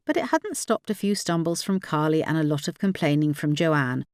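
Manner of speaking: plain reading voice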